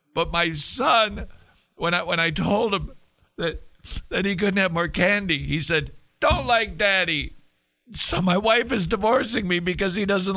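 high, hammed-up weepy voice; mock crying. Father explaining his divorce over his son, exaggerated crying. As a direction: wail through the story like a sobbing dad. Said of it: "and he's crying".

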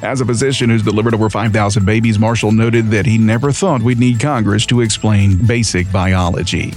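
ordinary talk

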